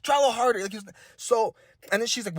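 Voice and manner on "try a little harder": put-on high, pleading coaching dad calling to his kid; sideline dad. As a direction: urge the kid on from the stands. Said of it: "he was just, you know".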